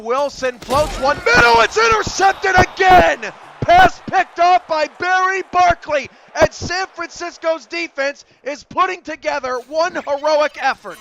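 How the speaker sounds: shouted, high interception call; excited call. Real, not acted. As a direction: shout the pick at full voice.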